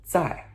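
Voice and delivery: zai starting high, falling sharply; fourth-tone zai. Shown as a demonstration. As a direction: say zai with a descending tone. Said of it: "that is the fourth tone".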